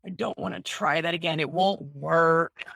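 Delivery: tired, fed-up groan that drags; burnt-out whine. Retold or imitated. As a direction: sound worn out and done with it. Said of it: "I was so burnt out from it".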